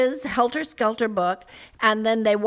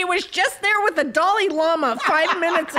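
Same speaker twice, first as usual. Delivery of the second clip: sarcastic; 7 semitones higher, 4 dB louder, faster than usual. Real, not acted. Sarcastic joke about the governor.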